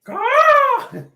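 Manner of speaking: very high, loud drawn-out screech; squawking yell. Real, not acted. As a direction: belt it out like a squawking parrot. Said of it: "slow down, [name]. Save some air".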